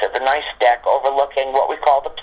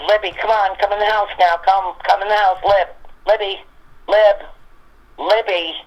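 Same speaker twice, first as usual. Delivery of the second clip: higher-pitched retold dog call; 6 semitones higher than usual. Auntie calling a dog in from the yard, coaxing call. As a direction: call the dog's name in short, repeated bursts.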